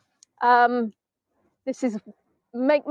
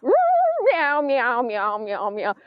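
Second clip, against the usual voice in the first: cat meowing; much slower than usual. Retold or imitated. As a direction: imitate a cat demanding its dinner. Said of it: "Milo's alarm clock goes off".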